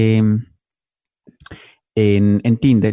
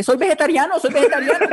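mock yelling vegetarian; 14 semitones higher than usual. Imagined vegetarian yelling at a butcher shop, mock high shout. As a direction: squeal it at the butchers like a silly protester. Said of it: "a gritarle a la gente".

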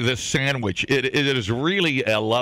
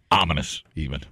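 ordinary talk

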